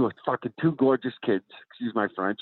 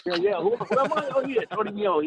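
obliging fake celebrity; 12 semitones higher than usual. Acting out signing as someone else.

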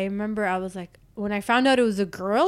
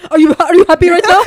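loud, high, fast laughing tease; 9 semitones higher, 12 dB louder, much faster than usual. Real, not acted. Asking the friend if they're happy, laughing loudly. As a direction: tease them through laughter.

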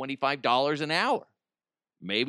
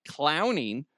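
ordinary talk, nothing marked

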